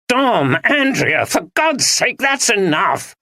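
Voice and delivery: high, slow commanding yell; shout. Acted. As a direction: shout to stop the fight. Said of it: "shouted Ben".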